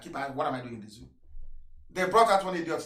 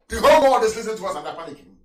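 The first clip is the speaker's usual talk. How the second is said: usual speaking voice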